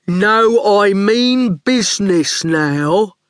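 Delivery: slow, smug thug voice; smug, daft lout. A narrator's reading. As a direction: say it slowly, daft and pleased with yourself. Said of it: "He sounded rather smug".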